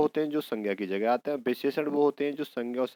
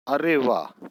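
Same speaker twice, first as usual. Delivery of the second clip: louder exclamation with a falling pitch; 2 semitones higher, 6 dB louder, much slower than usual. Shown as a demonstration. Teacher reads an exclamation from a grammar question, mildly exclaiming. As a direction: read it out as an example exclamation. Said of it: "तो ये होता है विस्मयादिबोधक".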